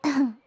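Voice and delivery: prim, pointed ahem; throat-clearing. Acted. Noble lady collecting herself after being laughed at, pointed throat-clearing. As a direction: clear the throat to regain dignity.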